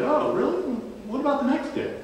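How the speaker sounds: mock innocence, needling him; teasing friend poking with a question; a bit of sarcasm. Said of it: "with a bit of sarcasm".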